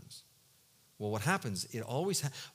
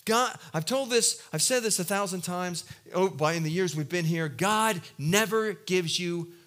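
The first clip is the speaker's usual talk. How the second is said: normal talking voice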